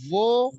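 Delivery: VO with a streaming voiced th, thick vowel; flowing sound. Shown as a demonstration. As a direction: let the start of vo flow on. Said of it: "mengalir suaranya".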